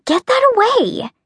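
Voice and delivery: high squeal, pulling away; squealing protest. A narrator's reading. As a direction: shriek it, half laughing. Drawing back from icing.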